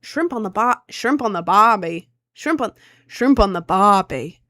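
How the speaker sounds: put-on clumsy stab at an Australian accent; bad Australian accent. Streamer trying an Australian accent for chat, halting. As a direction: fumble through a cliché Australian accent. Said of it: "how do you do an Australian accent".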